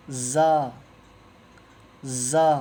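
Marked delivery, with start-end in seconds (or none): 2.0-2.5 زا with a long buzzing z. Shown as a demonstration. Teacher shows a buzzing زا to students. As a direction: let the z of زا buzz. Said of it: "جھنجھناہٹ جیسا آواز".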